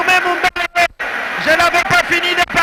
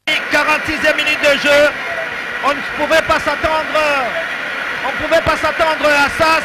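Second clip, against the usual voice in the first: goal call, raised and shouting; excited sports commentary; 5 semitones higher than usual. Real, not acted. Goal called live on radio.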